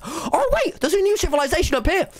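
high excited discovery. Real, not acted.

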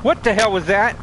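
high, loud startled demand; outraged shout. Real, not acted. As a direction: shout it in disbelief. Outraged at game mishap.

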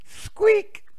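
very high, loud toy squeak; rubber duck squeak. Acted. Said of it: "says the little rubber duck".

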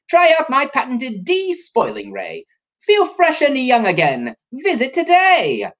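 bouncy rhymed advert reading, rising high; sales pitch. Acted. Reads brochure rhyme as a pitch.

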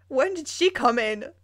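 normal talking voice

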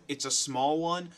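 ordinary talk, nothing marked

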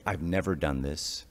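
ordinary delivery

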